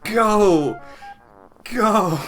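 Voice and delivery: high, loud repeated yells; shouting. Real, not acted. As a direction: yell it like a fan cheering. Cheering the droid on.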